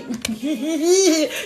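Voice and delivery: high, drawn-out giggle; laughter. Real, not acted. Reader delighted by the crystal key, high giggle.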